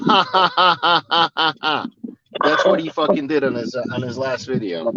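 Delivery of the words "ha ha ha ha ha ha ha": spelled-out laugh. Real, not acted. Laughs after quoting.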